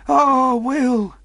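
high, loud exclamation; castaway voice. Acted. Castaway responds to the boy, high character voice. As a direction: exclaim as an eccentric old sailor. Castaway answers about his pious mother.

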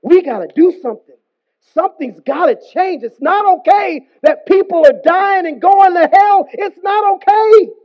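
impassioned plea building to a high shout; crying out. Acted. Heart of flesh crying out for humanity, impassioned shout. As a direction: preach it urgently, climbing to full voice. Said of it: "cries out for humanity and says".

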